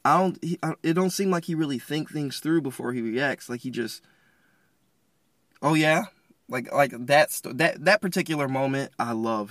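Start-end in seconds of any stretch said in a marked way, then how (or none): 5.6-6.0 higher, louder challenging retort; snapping. Acted. Gideon reacting before he thinks, snapping back. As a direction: throw it back as a mock-offended question. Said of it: "He just kinda snapped".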